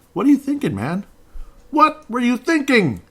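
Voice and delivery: can't believe what he saw; high, slowed exasperation; exasperated. Real, not acted. Exasperated rant at player.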